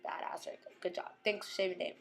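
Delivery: breathy whispered praise to the screen; hushed admiring whisper. Pretend. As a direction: whisper it like a secret cheer.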